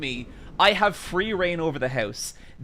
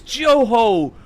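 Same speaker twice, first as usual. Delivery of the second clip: high, loud, hearty greeting; big retold welcome; exuberant welcome; 8 semitones higher, 9 dB louder, much slower than usual. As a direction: call it out like a delighted host greeting a guest. Host's greeting hug, retold.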